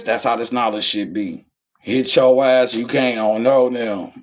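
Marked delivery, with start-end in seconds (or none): none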